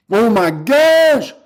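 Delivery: high, slow, stretched exclamation; amazed outburst. Real, not acted. Streamer amazed at closed deals, drawn-out ecstatic exclamation. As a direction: let the last word swell in disbelief.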